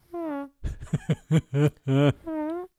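high-pitched burst of laughter; odd laughing noise. Real, not acted. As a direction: let out a squealing, silly laugh. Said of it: "make that sound on the air".

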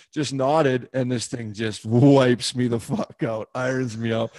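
usual speaking voice

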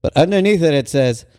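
ordinary talk

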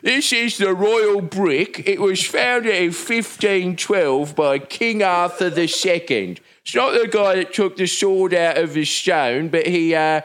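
high, hammed-up English town crier; beefeater. Comic mocking England's tourist patter, hammed-up beefeater voice. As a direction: cry it out like a pompous beefeater guide. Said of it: "everyone is dressed as a beef eater coming around and be like".

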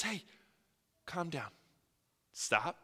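Jesus quietly calming his scared apostles; soothing Jesus. Acted. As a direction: hush them softly like a shepherd.

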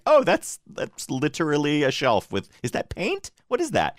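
high, amazed disbelief; surprised. Retold or imitated. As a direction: squeak it out in shock. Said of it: "I'm like".

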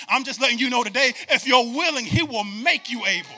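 loud, high, ringing preacher call; hammering the repeated "if"; preaching at full force. Acted. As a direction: build the congregation up with fire.

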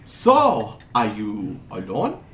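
put-on muddled European accent, fishing for company; wandering foreign accent. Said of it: "his accent keeps jumping around a lot".

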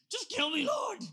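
high, loud mock wail of despair; hammed-up drama queen begging to die; melodramatic shout. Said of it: "you're a drama queen".

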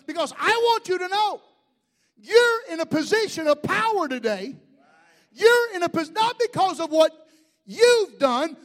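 loud, high preacher's proclamation; full-force preaching. Real, not acted. Pastor telling church they have power, full-force preaching. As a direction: drive every phrase home at full voice.

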